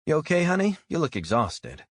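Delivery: a chirpy diner waitress checking on a customer; friendly waitress. Acted. Waitress asks a tired customer if he's okay, friendly voice.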